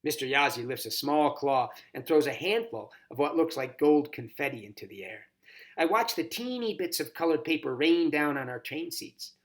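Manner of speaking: ordinary delivery, nothing marked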